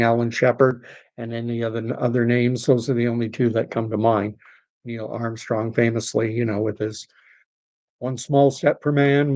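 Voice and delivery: ordinary talk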